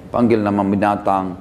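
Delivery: normal talking voice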